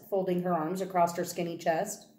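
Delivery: ordinary delivery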